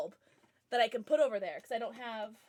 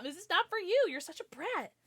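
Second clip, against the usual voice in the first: higher, teasing telling-off; mock scolding the dog; 7 semitones higher than usual. Real, not acted. Owner teasing her dog who wants the gift, mock scolding. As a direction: playfully scold the brat.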